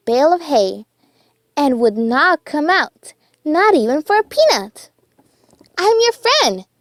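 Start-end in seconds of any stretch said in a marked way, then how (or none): 5.8-6.7 high, gentle mouse voice reassuring a friend. A narrator's reading. Mouse reassuring scared Dumbo in the hay, high and gentle. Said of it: "Timothy assured him".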